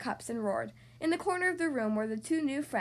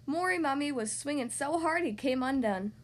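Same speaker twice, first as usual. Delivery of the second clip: ordinary delivery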